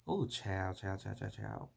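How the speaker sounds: normal talking voice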